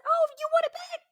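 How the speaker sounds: very high imitated child voice; cute little kid. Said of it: "He was cute".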